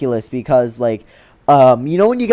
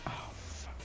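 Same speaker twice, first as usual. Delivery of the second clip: breathless whispered curse; called over by the cop, whispered in dread; 26 dB quieter than usual. Retold or imitated. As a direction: breathe it out, caught. Cop beckons him over.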